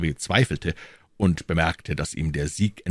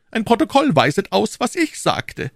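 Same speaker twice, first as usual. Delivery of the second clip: high, louder cardinal; 4 dB louder than usual. A narrator's reading. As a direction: say it out loud for the room. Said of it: "ganz laut".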